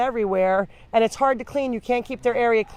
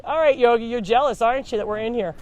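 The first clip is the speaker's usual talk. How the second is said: usual speaking voice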